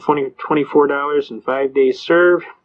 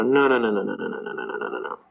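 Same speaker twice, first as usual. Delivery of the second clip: dismissive judge; 5 semitones lower, 6 dB quieter, faster than usual. Retold or imitated. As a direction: run of noes fading to a low mumble. Judge dismissing the excuse, voiced.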